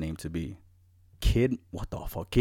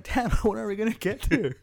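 laughing; 12 semitones higher than usual. Retold or imitated. Kid quote while laughing.

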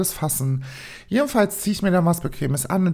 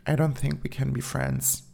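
softer, low, dry rejection; ganz trocken; 3 semitones lower, 6 dB quieter than usual. Retold or imitated. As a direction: turn him down drily, without fuss. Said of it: "sage ich zu ihm bloß ganz trocken". (ganz trocken und leise abgewiesen)

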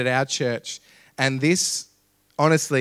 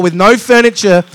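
much louder and higher, each phrase driven home; impassioned preaching climax; 6 semitones higher, 13 dB louder than usual. Real, not acted. Preacher ends coffee shop testimony to congregation, impassioned shout. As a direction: belt it out to the whole congregation.

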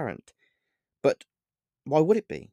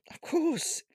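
panicked, much higher squeak; nervous squeak; 4 dB quieter than usual. Acted. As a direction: voice jumps up in fear. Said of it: "I said nervously".

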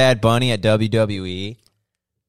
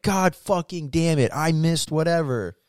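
higher pitched, imitating a dad losing his temper; mock furious dad; 8 semitones higher than usual. Retelling the dad cursing at a ringing phone, mock rage. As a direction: ham up a hot-tempered father cursing at the phone. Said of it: "My dad had a fucking temper".

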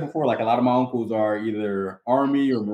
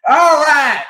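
much higher, louder fake Southern "All right"; fake Southern command accent; 12 dB louder than usual. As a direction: bark it in a put-on Southern drawl. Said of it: "fake Southern accent sometimes when they're commanding".

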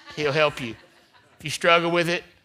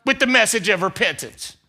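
loud, high preaching build; full-force preaching; 7 semitones higher, 6 dB louder than usual. Acted. Pastor preaching loudly to the congregation. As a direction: preach it out to the church.

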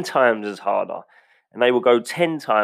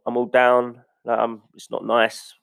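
ordinary delivery

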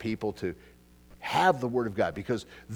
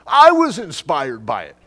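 mock enthusiasm; 12 semitones higher, 12 dB louder, slower than usual. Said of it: "I'm going".

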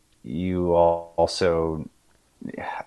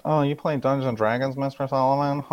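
put-on teasing kid; 7 semitones higher than usual. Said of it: "they always like to take their pot shots".

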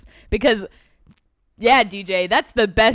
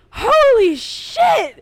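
much higher, louder, slow squealing fan voice; fanboy freakout; 14 semitones higher, 8 dB louder, much slower than usual. Retold or imitated. Kumail realizing it's Grey Worm, high squealing excitement. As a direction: gush like a starstruck fan.

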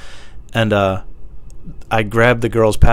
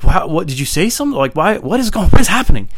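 high-pitched baffled questioning, building louder; baffled outburst; 8 semitones higher, 6 dB louder than usual. Retold or imitated. Man pressing coworker on who reported him, baffled outburst. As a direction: stumble upward into loud disbelief.

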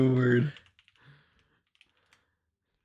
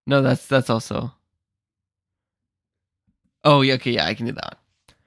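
normal talking voice

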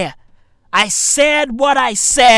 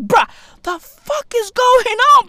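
high-pitched baffled outcry; acting out a high, panicked reaction; mock alarm; 13 semitones higher than usual. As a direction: cry out in exaggerated disbelief. Acted reaction to rabid dog.

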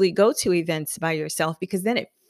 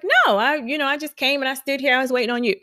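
friend's voice; 6 semitones higher, much faster than usual. Retold or imitated. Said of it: "And she was like".

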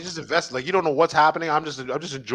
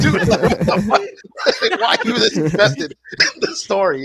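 laughing disbelief; 5 semitones higher than usual. Retold or imitated. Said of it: "And I'm like".

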